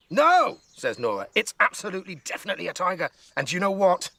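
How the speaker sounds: high, eager insisting; girl insisting to grandma, high and eager; insistent excitement. Acted. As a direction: lean in, eager and sure.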